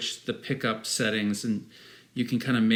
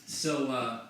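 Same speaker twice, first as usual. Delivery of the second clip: walking away; 6 dB quieter than usual. Real, not acted. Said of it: "I'll keep talking while I walk away".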